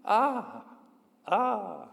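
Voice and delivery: a high echo of the scream, fading; a mock echo dying away; mountain echo. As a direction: call back like a distant echo. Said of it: "a voice repeating somewhere in the mountain".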